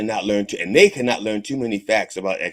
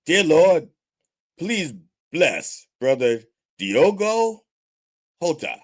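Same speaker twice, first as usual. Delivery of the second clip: higher, slow careful pulpit prayer; mock sermon prayer, slowly; preacher prayer; 7 semitones higher, slower than usual. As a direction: intone the name carefully like a preacher. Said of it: "One more time".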